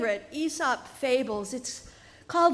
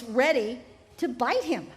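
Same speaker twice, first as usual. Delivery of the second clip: usual speaking voice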